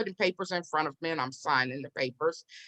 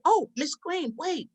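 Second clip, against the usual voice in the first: high imitated call to someone leaving; put-on high voice stopping someone; man calling after her; 9 semitones higher than usual. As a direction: call out sweetly, pitched up and drawn out at the end.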